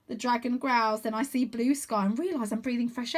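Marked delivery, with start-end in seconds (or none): none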